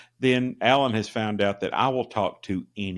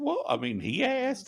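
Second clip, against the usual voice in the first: much higher, playing innocent; mock innocent shrug; 4 dB quieter than usual. Host defending podcast pitch to surgeon, mock innocent. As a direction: say it like it's obvious, a mock innocent. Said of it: "And I said".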